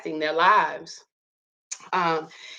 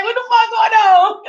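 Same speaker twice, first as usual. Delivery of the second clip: high, loud amazed laugh-talk; can't believe it, laughing; laughing disbelief; 11 semitones higher, 7 dB louder than usual. Real, not acted. Amazed reaction to the toddler.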